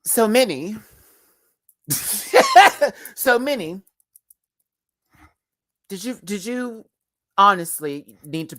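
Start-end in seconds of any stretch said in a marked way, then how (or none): 0.1-6.4 high, put-on British voice; playful British accent, pitched up; mock British accent. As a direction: confront them in a hammed-up British accent. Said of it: "I'm giving a better British accent".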